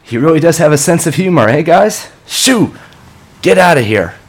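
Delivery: high, loud agitated yelling; shouting at crows. Acted. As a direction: shoo the birds off angrily. Shouting at crows, waving arms.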